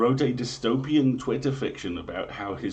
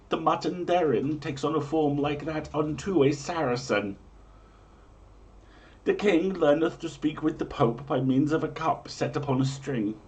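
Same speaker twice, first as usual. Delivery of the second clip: grand, raised olde-English storyteller read; dramatic prophecy reading; 4 semitones higher than usual. Acted. Host reading medieval omens to listeners, grand storyteller voice. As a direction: proclaim each omen like a town crier.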